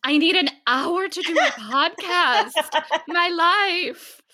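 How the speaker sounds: high, drawn-out self-mocking complaint; mock overwhelmed whine. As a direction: wail melodramatically like an overburdened new mother. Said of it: "and I'm like".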